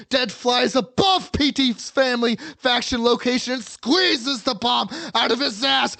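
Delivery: narration building to a high, loud shout; over-the-top storyteller losing all restraint; frenzied narration. A narrator's reading. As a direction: tell the climax at a feverish yell. Narration at the frantic climax.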